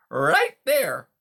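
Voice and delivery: loud, high smooth-talking hustler; a slick wise-guy beaver selling hard; fast-talking beaver. A narrator's reading. Beaver Conrad points.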